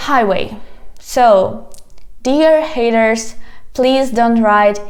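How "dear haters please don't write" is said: ordinary talk, nothing marked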